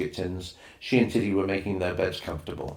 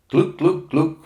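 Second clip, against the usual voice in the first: friendly clucking call; 8 semitones higher, 7 dB louder, slower than usual. Acted. Native mother makes a friendly call.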